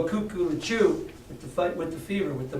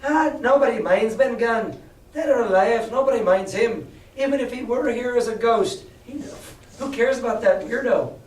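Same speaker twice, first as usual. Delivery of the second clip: scornful pirate; 6 semitones higher than usual. Acted. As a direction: scoff and wave it off. Pirate scoffs at Ben Gunn.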